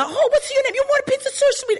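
high, syrupy sweet father voice; father's sweet voice. Retold or imitated. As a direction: coo at the customer like a doting old man. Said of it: "if he touches the pizza".

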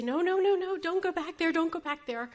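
high, loud, rapid-fire warning; alarmed calling out. Retold or imitated. Mother running after her small son, alarmed shout. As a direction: call out urgently to stop a child.

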